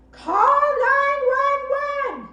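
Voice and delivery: slow, high, loud shout; yell. Shown as a demonstration. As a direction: drag each word out so the whole street hears. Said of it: "now I want you all to yell".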